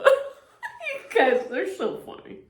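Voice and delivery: laughing through words. Real, not acted. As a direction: speak while laughing. Laughing at parents.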